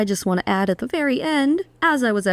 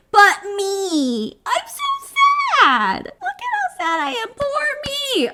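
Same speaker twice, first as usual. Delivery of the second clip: much higher, hammed-up whiny crying; mock self-pity wail; 12 semitones higher than usual. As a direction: overplay poor-me sobbing to mock her. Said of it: "She's like".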